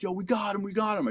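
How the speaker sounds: high, urgent retold call; imitated excited calling. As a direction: call out the catch to the squad, without real volume. Squad's excited calls, acted.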